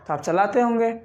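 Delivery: usual speaking voice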